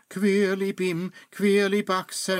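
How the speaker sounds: hurried incantation; quick spell. Acted. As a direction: rush the spell out. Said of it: "zauberte schnell".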